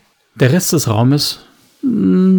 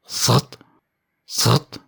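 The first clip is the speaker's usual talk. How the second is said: a short buzzing srrt twice; mechanical buzz imitation. A narrator's reading. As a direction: hum it like a stun device zapping. Sound of a device.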